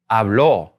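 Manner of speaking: habló with a strong, falling final Ó; stress on final O. Shown as a demonstration. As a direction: lean firmly on the Ó of habló. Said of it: "when we say it with that accent".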